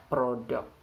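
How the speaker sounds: "product" with the stress on the first syllable, PRO. Shown as a demonstration. Teacher showing students where the stress falls, stressing the first syllable. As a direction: hit the start of "product" and let the end fall away. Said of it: "It occurs in the first syllable".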